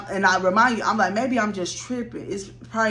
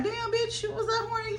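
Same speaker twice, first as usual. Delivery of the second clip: squeaky high mock voice, much higher and softer; a high, soft, teasing mock of the viewers; mocking audience voice; 5 dB quieter than usual. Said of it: "I know y'all probably thinking".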